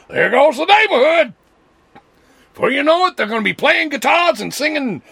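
mock conservative neighbour wailing about lefties moving in. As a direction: panic about the new neighbours, loudly.

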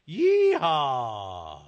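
drawn-out cowboy holler; yeehaw. Acted. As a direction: whoop it like a rodeo cowboy. Host's rodeo call.

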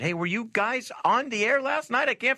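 lightly voiced high question; a hint of a puzzled listener; imitating listener. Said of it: "Sasha, though, said".

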